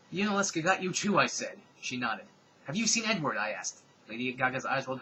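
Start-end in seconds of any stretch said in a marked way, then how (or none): 0.1-1.2 excited quote. Acted. Dramatic reading of fanfic quote. 2.7-3.4 urgent question. Acted. Urgent question in read story.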